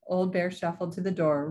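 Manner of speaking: ordinary delivery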